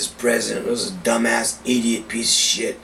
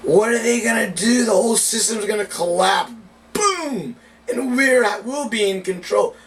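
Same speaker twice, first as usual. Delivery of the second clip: excited; 7 semitones higher than usual. Real, not acted. Building rant about collapse.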